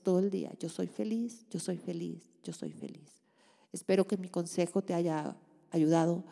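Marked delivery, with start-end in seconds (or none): 0.6-3.2 soft affirmation fading to a whisper. Shown as a demonstration. Closing her advice with a whispered affirmation. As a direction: let the mantra sink into silence.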